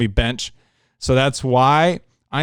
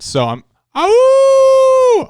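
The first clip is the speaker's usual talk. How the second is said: long high howl like a wolf pup; 9 dB louder, much slower than usual. Real, not acted. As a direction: let out a drawn-out howling cry. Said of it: "I'll give a little howl".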